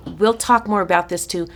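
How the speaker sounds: ordinary talk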